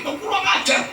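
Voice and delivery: put-on high, indignant retort from an irritated old man; imitating a hot-tempered hajji scolding the youths; mock angry scolding. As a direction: snap back at the rude kids, pitch jumping up. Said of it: "Marah, maki-maki".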